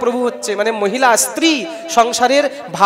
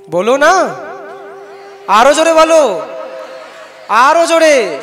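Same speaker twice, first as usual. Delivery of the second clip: raised, forceful call to the crowd; loud urging; 4 semitones higher, 7 dB louder than usual. Real, not acted. Preacher rousing his listeners, loud urging call. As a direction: push the crowd to shout louder.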